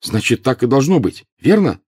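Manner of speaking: put-on urgent boy seeking agreement; fervent exclamation. A narrator's reading. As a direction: press the point hotly. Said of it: "горячо воскликнул Гарри".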